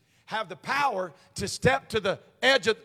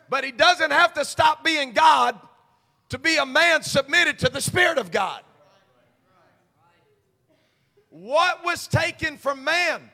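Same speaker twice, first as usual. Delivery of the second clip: preaching; 5 semitones higher, 7 dB louder than usual. Acted. Preaching builds to a shout.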